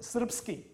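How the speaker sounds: Srpski with the rpsk cluster said tight, no vowel between; Serbian Srpski. Shown as a demonstration. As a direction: say Srpski in Serbian, consonants packed together. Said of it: "und Serbisch als Adjektiv?".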